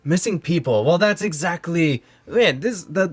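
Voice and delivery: high-pitched, amazed mayor; excited mayor. Acted. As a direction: marvel at the coincidence as the mayor. Mayor reacts to the quest.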